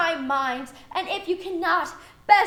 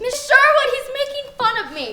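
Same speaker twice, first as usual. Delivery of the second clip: whiny tattling; 6 semitones higher, faster than usual. Real, not acted. Student complains to teacher.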